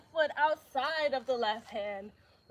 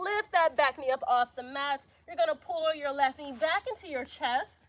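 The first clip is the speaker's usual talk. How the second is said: ordinary delivery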